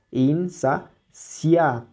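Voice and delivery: "insaciable" very slowly as in-sa-cia-ble; syllable by syllable. Shown as a demonstration. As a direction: split "insaciable" with pauses, keeping "cia" as one syllable. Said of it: "se pronuncian en una sola sílaba". ("insaciable" muy lento, separado en sílabas)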